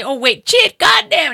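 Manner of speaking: high-pitched mock holler of amazement; imitated catcall. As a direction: mimic a catcaller, rising high on the curse. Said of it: "talking to me and he's yelling".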